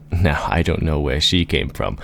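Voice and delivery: ordinary delivery, nothing marked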